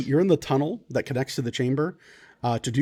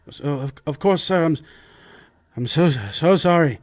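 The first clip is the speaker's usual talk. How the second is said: apologetic servant; 2 semitones higher, 4 dB louder than usual. Acted. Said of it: "servant apology".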